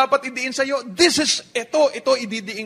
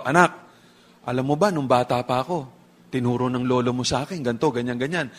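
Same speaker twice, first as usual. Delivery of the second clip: lower, fast lecturing dad voice; imitating a dad; 10 semitones lower, faster than usual. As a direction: rattle it off like a dad repeating an old lesson. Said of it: "Sabihin ng tatay ngayon, ganito".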